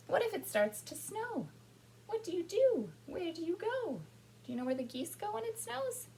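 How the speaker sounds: high, lilting picture-book question; reading to children. A narrator's reading. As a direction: ask the children with high, wondering pitch.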